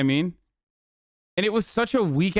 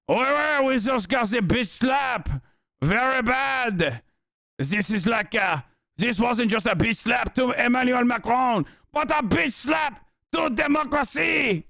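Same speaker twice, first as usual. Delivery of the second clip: mock French accent; 5 semitones higher than usual. Mocking France's horror at a slap, high hammed-up French accent. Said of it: "Meanwhile, in France, it's like".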